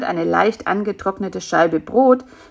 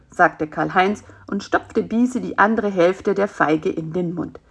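usual speaking voice

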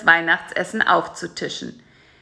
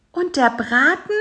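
high fly question; 7 semitones higher than usual. A narrator's reading. Bisi asks.

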